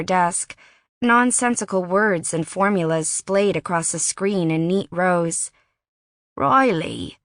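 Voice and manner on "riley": a warning tone on the name; note of warning. A narrator's reading. Wes cautions Riley before breaking bad news, warning. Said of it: "a note of warning in his voice".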